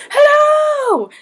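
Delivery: high, loud shouted hello; old man shouting hello at a foreigner in the street; man screaming hello. Retold or imitated. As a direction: yell it at a passing foreigner. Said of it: "a fifty-year-old man scream".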